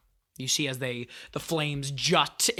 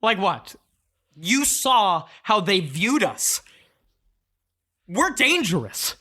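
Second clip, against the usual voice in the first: indignant argument; 6 semitones higher, 4 dB louder than usual. Acted. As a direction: argue back bitterly, higher and louder. Angry emotion argues with Calm.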